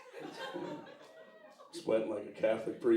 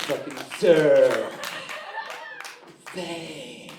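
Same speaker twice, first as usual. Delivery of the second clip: outraged correction; 5 semitones higher, 8 dB louder, much slower than usual. Retold or imitated. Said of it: "It's like".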